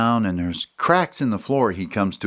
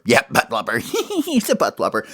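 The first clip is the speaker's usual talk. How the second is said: high giggly teasing chant; teasing giggle; 4 semitones higher than usual. Acted. Smalls and Hope teasing Ollie, giggling.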